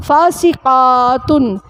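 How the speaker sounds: "فاسقاتن" stretched out slowly with the final -tun clear; slow teaching read. Shown as a demonstration. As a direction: say "فاسقاتن" slowly so the class hears the ending. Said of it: "نافرمان عورتیں".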